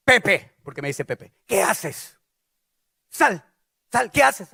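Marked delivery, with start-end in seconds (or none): 0.1-0.6 loud, sharp call of a name; mother calling out. Acted. Mother at bathroom door, loud stern call. 1.5-4.5 loud, demanding questioning; stern mother. Acted. Mother at bathroom door, loud stern demand.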